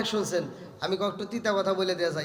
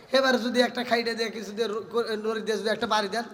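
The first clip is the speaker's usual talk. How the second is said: ordinary talk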